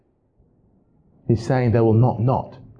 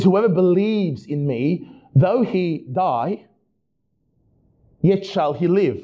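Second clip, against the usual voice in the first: solemn scripture reading; 6 semitones higher, slower than usual. Acted. Pastor reads Jesus' words aloud.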